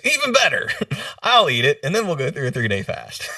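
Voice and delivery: high-pitched, laughing imitation of his mentor; gleeful retold comeback. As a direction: crack up while quoting the mentor's comeback.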